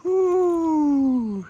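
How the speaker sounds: high joyful whoop; excited whoop. Real, not acted. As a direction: cheer with delight. Cheering at new wheels.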